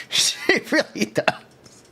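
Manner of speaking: slow breathy laugh over words. Real, not acted. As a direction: laugh it out slowly. Laughing agreement about VTuber look.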